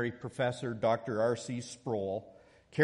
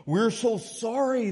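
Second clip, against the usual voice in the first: pleading students; 10 semitones higher, 6 dB louder than usual. Retold or imitated. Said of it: "they said".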